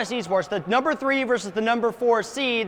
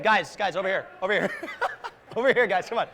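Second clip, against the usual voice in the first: off-mic calling, softer and laughing; calling from far away; 4 semitones lower, 4 dB quieter, faster than usual. Real, not acted. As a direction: call players over from afar. Calls players over off mic.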